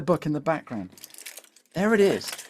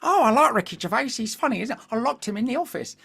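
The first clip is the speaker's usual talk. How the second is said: wife's voice; 4 semitones higher than usual. Retold or imitated. Husband retelling his wife's remark about Netflix, lightly voiced. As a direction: nudge it up so she sounds like someone else. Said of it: "so that you know it's two different people".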